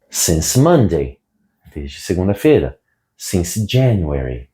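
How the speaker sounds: normal talking voice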